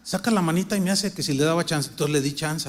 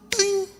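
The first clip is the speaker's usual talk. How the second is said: alarm ding; 14 semitones higher than usual. Retold or imitated. Imitates the alarm clock.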